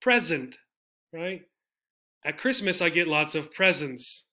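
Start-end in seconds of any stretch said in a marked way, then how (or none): 0.0-0.6 PREZ-ent, falling from the first syllable; first-syllable stress. Shown as a demonstration. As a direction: hit "pres" in present.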